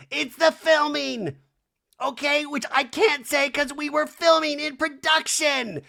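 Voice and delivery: high, loud, hammed-up complaint; mock exasperated outburst. Kyle pointing out the cameras, mock exasperated. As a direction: imitate a housewife protesting.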